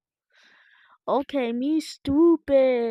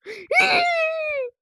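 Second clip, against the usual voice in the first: startled gasp; 4 dB louder than usual. Real, not acted. Sudden scare in game.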